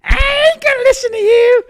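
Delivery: mock a stubborn person who won't listen; stubborn know-it-all. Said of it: "that's how a lot of people are".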